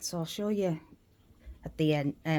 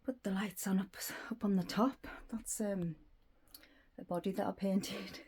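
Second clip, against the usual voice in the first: whispering; 10 dB quieter than usual. Real, not acted. Whispering asides while filming.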